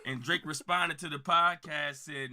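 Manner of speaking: normal talking voice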